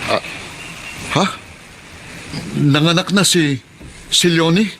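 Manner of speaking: husband stunned to learn his wife gave birth; stunned surprise. Acted. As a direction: let the news hit you mid-sentence. Shocked news of wife giving birth.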